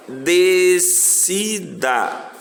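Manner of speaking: DECIDA very slowly in three drawn-out syllables; teacher sounding out each syllable of "Decida" separately; piece by piece. Shown as a demonstration. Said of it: "vai falando pedaço por pedaço".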